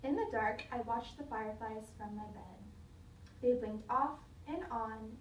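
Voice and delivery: ordinary delivery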